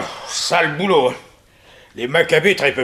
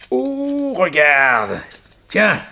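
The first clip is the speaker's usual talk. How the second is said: normal talking voice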